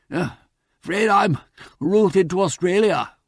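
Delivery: panting. Acted. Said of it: "panted Peter apologetically".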